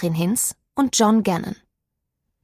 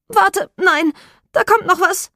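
high, urgent queasy voice; high-pitched warning before being sick; queasy drunken friend; 10 semitones higher, 4 dB louder than usual. A narrator's reading. Drunk friend warns she will vomit, high queasy urgency.

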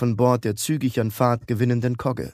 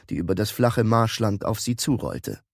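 usual speaking voice

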